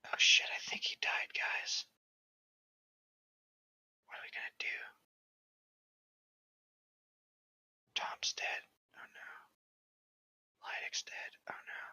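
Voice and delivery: hushed whisper over the deaths; a real alarmed whisper. Real, not acted. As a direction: breathe it out under your voice, no tone. Hushed reaction to characters dying.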